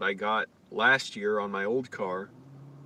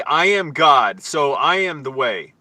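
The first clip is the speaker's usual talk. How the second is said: loud, slow preaching proclamation; parodying the Christians at the park, booming slowly; mock preacher; 3 semitones higher, 12 dB louder, slower than usual. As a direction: boom it out like a street preacher.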